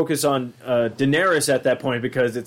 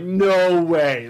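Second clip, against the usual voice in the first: mock disbelief; 5 semitones higher, 4 dB louder than usual.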